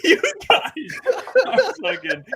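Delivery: real laughter through the words; cracking up at the two arguing hosts; laughing. Real, not acted. Cohost cracking up at debate.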